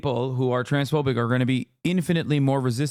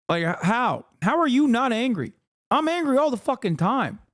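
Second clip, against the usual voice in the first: much higher, heated and exasperated; real anger; 8 semitones higher than usual. Real, not acted. Explaining to a critic why he yells, furious and high. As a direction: let the fury climb way up in pitch.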